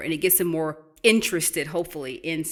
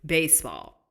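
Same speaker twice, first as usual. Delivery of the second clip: usual speaking voice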